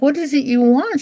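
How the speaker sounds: a lightly put-on friendly breeder; mock sweet question. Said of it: "I'm like".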